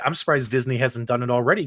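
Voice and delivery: ordinary delivery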